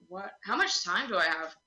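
usual speaking voice

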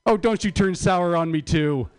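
ordinary talk

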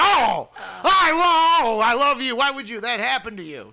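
high, loud wail of horror, easing off; retold scream. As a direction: cry out in exaggerated shock. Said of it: "I just screamed like a bitch".

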